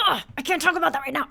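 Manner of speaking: imitating a stressed husband snapping him off; irritated husband brush-off. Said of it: "And my husband says".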